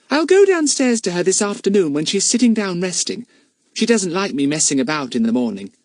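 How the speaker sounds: put-on complaining little girl; a young girl grumbling, pitched high; little girl's voice. Bets continuing.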